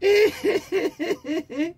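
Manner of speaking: high, long laugh. Real, not acted. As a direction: crack up at the punchline. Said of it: "laughs at own joke". (malakas na tawa)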